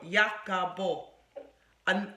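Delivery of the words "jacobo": Jacobo drawn out slowly as Ya-co-bo; slow careful pronunciation. Shown as a demonstration. Author slowly teaches the interviewer her book title, carefully. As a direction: spell Jacobo out slowly, syllable by syllable. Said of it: "It's like Jacob O".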